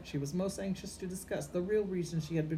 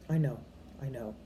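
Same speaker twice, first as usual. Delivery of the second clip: ordinary delivery, nothing marked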